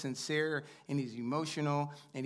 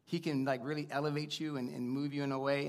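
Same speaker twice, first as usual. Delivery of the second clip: normal talking voice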